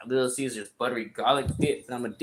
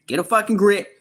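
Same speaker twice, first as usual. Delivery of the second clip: much higher and loud; shouted advice; 10 semitones higher, 8 dB louder than usual. Real, not acted. Advising young men to leave, shouted. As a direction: punch it out as a shout.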